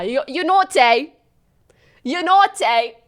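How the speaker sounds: loud mock British accent. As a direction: exaggerate the British accent, rising. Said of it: "so they have an accent".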